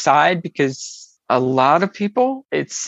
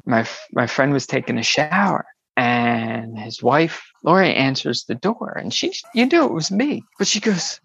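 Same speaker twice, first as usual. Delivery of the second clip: ordinary delivery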